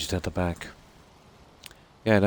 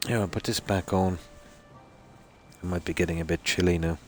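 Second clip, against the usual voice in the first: ordinary delivery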